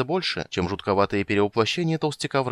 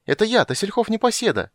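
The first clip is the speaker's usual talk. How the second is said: very high, eager call; excited high-pitched cry; shouting; 13 semitones higher, 4 dB louder than usual. A narrator's reading. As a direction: announce yourself at a distance. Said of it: "крикнул он".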